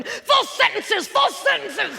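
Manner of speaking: high, slow barking command; handler with a whip. Retold or imitated. Handler drilling the candidate with a whip. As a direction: crack the whip on a dumb pupil. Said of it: "holding a whip going".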